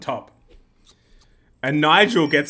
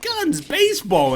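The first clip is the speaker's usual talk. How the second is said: very high, slow proclamation; brash American; 13 semitones higher, slower than usual. Acted. American guy throwing out his claim, over the top. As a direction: stretch each word out high and proud.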